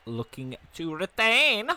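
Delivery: building high play-by-play call; excited commentary. Acted. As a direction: draw out the last word, rising high. Commentator calls a big move.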